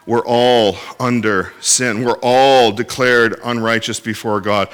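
ordinary talk, nothing marked

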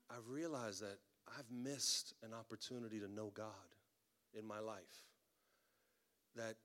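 low, soft, halting retold confession; humble confession. Officer admitting to pastor he resisted God, quiet humble confession.

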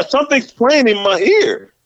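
ordinary talk, nothing marked

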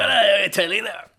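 put-on slow, lightly done Ulster accent; Ian Paisley voice. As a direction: drag it out in a hint of Ulster. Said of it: "I went a bit Ulster Says No there".

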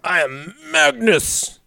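slow, high booming proclamation; booming demon lord. Pretend. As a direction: proclaim it like a dark overlord. Grandiose Magnus impression.

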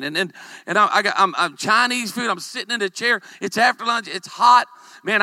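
ordinary delivery

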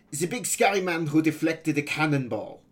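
put-on high, loud boy pirate with a foreign accent. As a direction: pipe up loudly as an accented young pirate.

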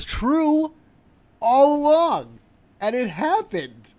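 high, loud exasperated outburst; angry rant. Acted. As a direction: blow up in disbelief at the Nets. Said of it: "I know exactly why I'm angry".

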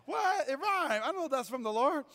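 high, playful mock wonder; mock amazed. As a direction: send up a believer thrilled by a rhyme. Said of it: "you're like".

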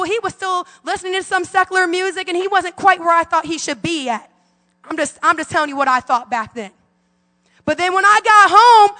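ordinary talk

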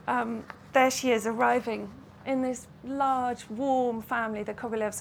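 usual speaking voice

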